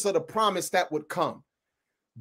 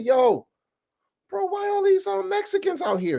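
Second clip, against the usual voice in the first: amazed question; 10 semitones higher than usual. Retold or imitated. Retold question to friend.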